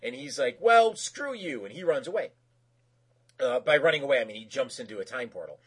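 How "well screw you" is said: high-pitched, drawn-out mock huff; petulant parting shot, hammed-up; retold defiant retort. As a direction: imitate a husband flouncing off. Acted husband's parting retort.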